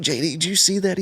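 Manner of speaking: hyped to share what he saw; excited call. Retold or imitated. Rider asking his buddy after a run, excited.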